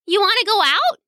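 high, curious little-princess voice; little girl. A narrator's reading. Little princess asking the cat at the gate, curious.

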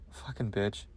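very soft, low mock contempt; muttered insult. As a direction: curse the baby under your breath.

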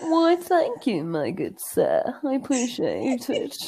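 slow, swooping put-on posh accent. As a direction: thank them in a drawn-out mock-genteel accent. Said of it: "now that I put on that accent".

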